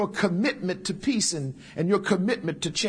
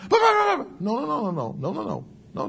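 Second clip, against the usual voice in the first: mock frantic; 6 semitones higher than usual. Excited caller protesting to the preacher, mock frantic. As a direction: cut in like a flustered caller. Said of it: "they be all excited".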